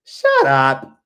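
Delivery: put-on loud, high French-Caribbean-accented scolding; emphatic "shut up" in a French-Caribbean accent trying to sound American; grandmother's accented emphasis. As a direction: imitate a French-Caribbean grandmother hushing noisy kids. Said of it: "say it with, like, a little, like, emphasis".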